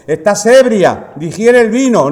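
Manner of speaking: put-on the priest accusing Ana of being drunk. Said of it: "le dijo".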